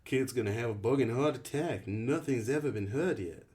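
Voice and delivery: playful English rebuke of a friend; weird British accent. Acted. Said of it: "His voice was thick with a weird accent".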